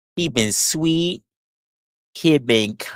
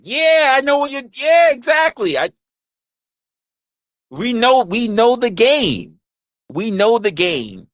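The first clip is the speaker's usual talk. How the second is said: excited high shout; 10 semitones higher than usual. Real, not acted. Comedian hyping the crowd with a high shout. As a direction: yell it up high, hyped.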